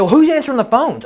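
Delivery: high, loud demanding client voice; mock angry client. Client demanding answers from the roofer, high and loud. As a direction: grill him in a high put-on voice. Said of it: "He said".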